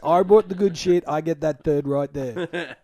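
hammed-up defending his cheese from mates; mock possessive claim. Claiming his own brie wheel from the group, mock loud.

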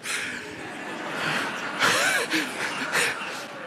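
breathy laugh. Real, not acted. Laughing after joke.

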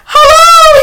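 high, loud wailing hello; distraught phone greeting. Retold or imitated. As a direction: wail it into the phone, hammed-up. Retold distraught phone call to owner.

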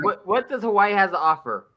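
ordinary talk, nothing marked

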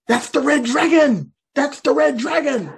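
high, slow, loud exclamation; hammed-up alarmed cry. Crowd in China claiming the landed UFO, high hammed-up cry. Imitates Chinese people fighting over alien.

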